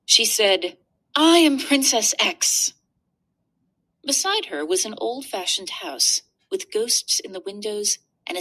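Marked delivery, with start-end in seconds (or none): 1.1-2.7 bright, higher little princess voice; proud young princess announcing herself; girl princess voice. Acted. Princess speaks in story.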